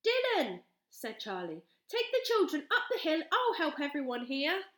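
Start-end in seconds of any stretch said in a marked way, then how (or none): 0.0-0.6 high loud call. A narrator's reading. Calling the dragon. 1.9-4.7 high loud urgent order; urgent shout. A narrator's reading.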